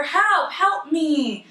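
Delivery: a drowning stranger calling out, slow mock plea; mock cry for help. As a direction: stretch out a pretend plea for help. Drowning person calls for help.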